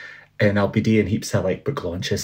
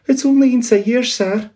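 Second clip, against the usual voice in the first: much higher, begging clerk; pleading Bob; 5 dB louder than usual. Acted. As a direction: plead for mercy like a frightened clerk. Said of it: "pleaded Bob".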